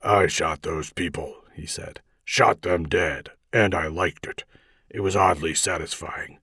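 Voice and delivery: low, deliberate vigilante voice; dark, low-pitched hero rehearsing villainy; Batman. A narrator's reading. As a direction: confess it low and subdued. Batman rehearses a false confession.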